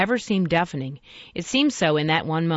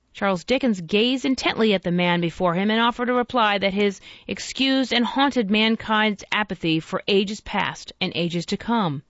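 usual speaking voice

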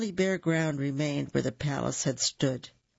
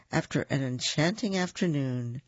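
ordinary delivery

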